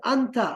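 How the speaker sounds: अंता said clearly, pointing ahead; teacher modelling अंता for students; model word anta. Shown as a demonstration. Teacher models the word for students, firm and clear. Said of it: "और कहना है".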